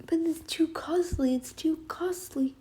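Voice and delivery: a hushed, whiny imitation of a cheap excuse; mock whispered excuse. Mocking viewers' excuse.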